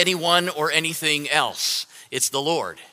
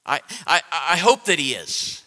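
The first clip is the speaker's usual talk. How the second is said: ordinary talk, nothing marked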